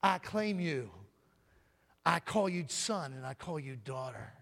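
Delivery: ordinary talk, nothing marked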